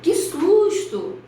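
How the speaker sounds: high, slow gasp of fright; startled exclamation. Shown as a demonstration. Teacher [name] acting out a fright for the class, startled. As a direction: cry out as if suddenly scared. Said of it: "a tia [name] vai dar um exemplo".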